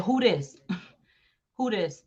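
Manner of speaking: lightly voiced dismissive text; a hint of a fake innocent voice; mocking Kim. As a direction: play dumb, mildly laughing. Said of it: "hit him up and said".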